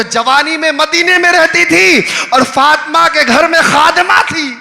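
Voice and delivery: loud, high climax of the sermon; shouted peak of a mourning sermon; preaching at full force. Acted. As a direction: belt it out to the crowd. Shouted line then repeated softly.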